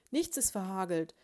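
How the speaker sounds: loud, emphatic denial; heated, boastful contradiction; irritated yell. A narrator's reading. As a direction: insist at full voice. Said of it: "schrie Ambrosius Dauerspeck".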